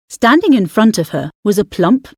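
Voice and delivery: ordinary delivery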